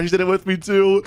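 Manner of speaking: ordinary delivery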